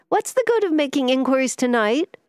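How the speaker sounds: high, pleading protest. A narrator's reading. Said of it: "murmured pleadingly".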